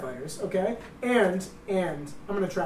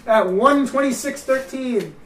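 mock announcer voice; 5 semitones higher, 7 dB louder, slower than usual. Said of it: "Is that not to approve right there?".

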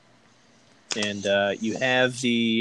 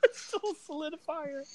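laughing. Real, not acted. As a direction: giggle the words out. Laughing at gross item.

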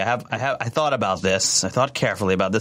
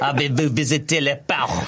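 French phrase. Retold or imitated. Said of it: "I was learning French".

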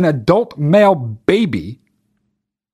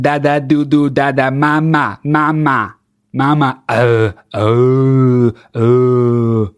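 mock baby babble; 4 semitones lower, slower than usual. Mocks baby at work checkout.

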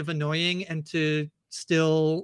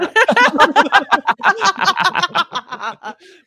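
long laugh, much higher and louder; 12 semitones higher, 11 dB louder than usual. Real, not acted. Podcaster cracking up at the MCU joke, loud laugh. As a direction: burst out laughing.

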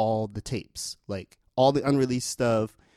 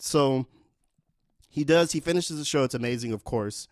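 ordinary delivery

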